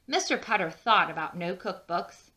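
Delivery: usual speaking voice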